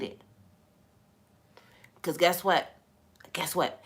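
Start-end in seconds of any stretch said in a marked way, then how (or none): none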